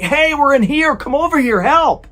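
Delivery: high, loud re-enacted scream for rescue; urgent, high-pitched acted yell; screaming for help. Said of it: "hearing a woman inside of the vehicle screaming".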